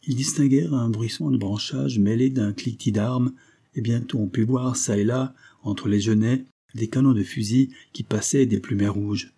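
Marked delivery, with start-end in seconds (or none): none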